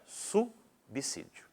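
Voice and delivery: subsídio said slowly, s not z; drawn-out subsídio with a clear s; careful slow subsídio; slow correct pronunciation. Shown as a demonstration. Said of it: "falando de forma correta".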